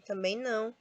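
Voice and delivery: ordinary talk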